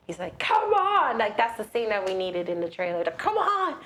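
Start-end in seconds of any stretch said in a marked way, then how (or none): 0.4-1.2 high yelled challenge; mock yell. Dad calling out the monster, mock yell. 3.2-3.8 high yelled challenge; mock yell. Dad calling out the monster, mock yell.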